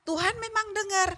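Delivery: high cheeky retort; cheeky child. Retold or imitated. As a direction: answer the sister slyly. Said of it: "Lalu dia jawab begini".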